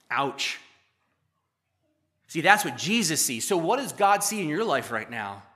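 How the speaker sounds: usual speaking voice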